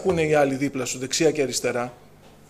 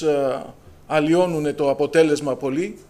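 normal talking voice

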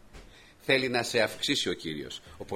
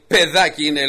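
scoffing giant; 4 semitones higher, 11 dB louder than usual. Acted. Goliath scoffs at David.

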